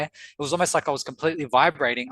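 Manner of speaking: ordinary delivery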